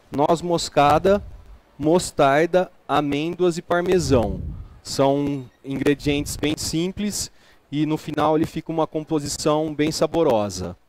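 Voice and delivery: ordinary delivery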